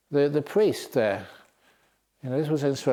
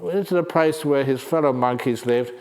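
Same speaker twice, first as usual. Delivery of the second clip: usual speaking voice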